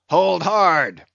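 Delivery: loud high cry of alarm; sharp shout. Acted. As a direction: yell it as the line snaps taut. Old reelman shouts as line strains.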